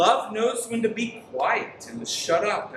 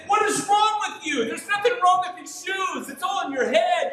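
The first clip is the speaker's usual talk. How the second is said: imagined scolding shout; 10 semitones higher than usual. Pretend. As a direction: yell at him like a fed-up parent, half in jest. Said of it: "I wanna say".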